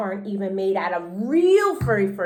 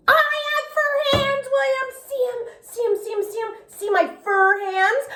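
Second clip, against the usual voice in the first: put-on much higher, eager little girl; high excited voice; 13 semitones higher than usual. A narrator's reading. Junie B showing her fur hands to William, high and eager.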